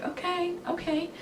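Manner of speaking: small stunned reply. Retold or imitated. Fan retelling her reply to Ellen's staff, small hushed voice. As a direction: shrink it to a tiny high acknowledgement.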